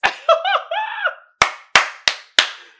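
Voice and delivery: loud high burst of laughter; shrieking laugh. Real, not acted. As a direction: howl with laughter. Cracks up at the meme.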